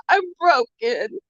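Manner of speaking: high, tearful, voice cracking with sobs; retelling breaking down in tears to the doctor. Retold or imitated. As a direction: relive the moment of falling apart crying. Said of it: "I started bawling".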